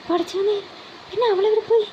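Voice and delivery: high, alarmed, worried question; anxious cry. Acted. Father figure learns the girl is missing, high alarmed question. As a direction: panic rising as he asks where she went. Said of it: "Najeeb വേവലാതിയോടെ".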